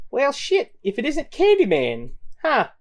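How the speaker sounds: high-pitched mocking villain; taunting gang leader.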